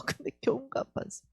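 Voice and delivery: whispered confession; hushed aside. Real, not acted. Preacher confiding to listeners in a whisper. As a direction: drop to a breathy whisper.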